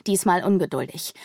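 plain reading voice

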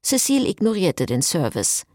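ordinary delivery

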